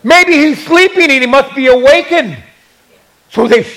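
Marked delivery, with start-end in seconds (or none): 0.0-2.4 sarcastic. Acted. Elijah mocking the silent god's worshippers, sarcastic. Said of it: "Elijah used the gift of sarcasm".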